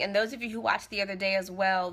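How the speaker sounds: ordinary delivery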